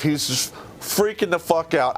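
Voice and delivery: ordinary talk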